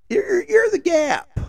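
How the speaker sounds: very high, slow mock shriek of outrage. Customer mocking Gap cashiers, high mock shriek. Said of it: "I said".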